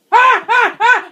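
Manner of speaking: high, loud shrieks of real terror; terrified screams. Real, not acted. Gamer meeting the monster again, screaming in terror. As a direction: scream as he appears.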